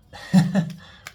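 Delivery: loud breathy burst of laughter; airy high laugh. Real, not acted. Reader laughing after joking about dating Aquarius, loud airy laugh. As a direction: let a real laugh burst out. Laughs at her own joke.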